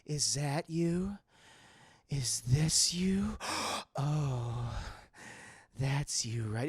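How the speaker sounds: low, slow breathy purr; low slow mock-seductive Catwoman; sultry Catwoman. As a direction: slowly tease it out with a gasp. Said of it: "When she starts, like".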